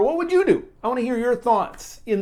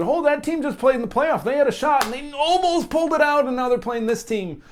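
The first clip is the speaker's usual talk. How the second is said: higher, chatty fan voice, quoting what people would say; a fan voice, pitched up, telling a friend about the playoff team; imagined excited fan; 8 semitones higher than usual. Pretend. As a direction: play a hyped-up fan recapping the game.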